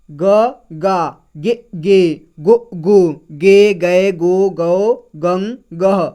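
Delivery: ग, गा sounded out slowly, one by one; drawn-out teacher recital of ग to गह; syllable drill. Shown as a demonstration. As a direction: sound out each syllable for young learners. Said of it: "तो इस तरह से पढ़ेंगे".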